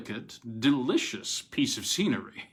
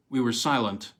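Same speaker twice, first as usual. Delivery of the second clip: plain reading voice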